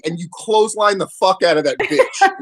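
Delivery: normal talking voice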